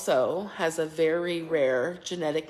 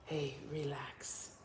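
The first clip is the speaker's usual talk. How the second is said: soothing; 10 dB quieter than usual. Real, not acted. Mother soothing upset baby.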